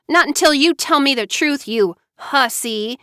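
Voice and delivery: 愤怒地顶回去; angry retort. A narrator's reading. Furious sister refusing to leave.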